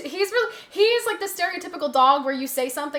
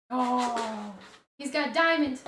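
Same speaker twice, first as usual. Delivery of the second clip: ordinary delivery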